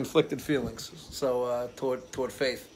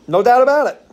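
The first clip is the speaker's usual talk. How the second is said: a much higher, louder tough-guy repeat of the line; mob boss echo; 9 semitones higher, 10 dB louder than usual. Retold or imitated. Echoing the mafia pastor, loud put-on tough-guy voice. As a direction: bark it back like a wiseguy.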